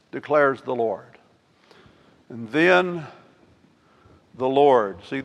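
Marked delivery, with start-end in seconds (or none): none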